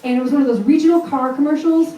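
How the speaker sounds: usual speaking voice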